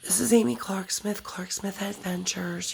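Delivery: groggy, drained voice after anesthesia; weak after surgery. Real, not acted. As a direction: talk weakly, still worn out from surgery. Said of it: "excuse the way that I'm talking".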